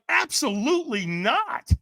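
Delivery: slow, high emphatic no; firm mock refusal; flat refusal. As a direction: refuse it outright. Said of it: "And I'm like".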